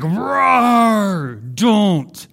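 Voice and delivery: mock beastly growl, drawn out and loud; angry roar. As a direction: roar to make everyone back away. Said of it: "and then she's like".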